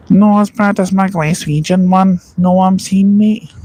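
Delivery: jokey over-the-top Glaswegian impression; bad Glaswegian accent. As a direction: ham up a terrible Glasgow accent. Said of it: "That's terrible as well".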